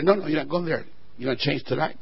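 low, soft and firm; hushed, low voice of God; God's quiet refusal. Acted. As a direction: drop low to voice God's gentle correction.

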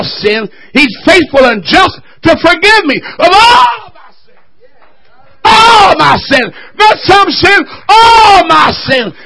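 high, loud revival shouting, long-held words; full-force preaching. Real, not acted. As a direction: cry it out to the whole church.

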